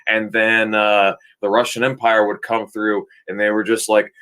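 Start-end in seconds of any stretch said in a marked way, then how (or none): none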